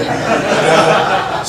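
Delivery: ordinary delivery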